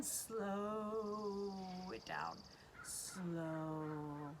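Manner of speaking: low, long drawn-out SLOW; slowing right down. Pretend. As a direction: stretch the words like wings winding down. Voice mimics slowing butterfly wings.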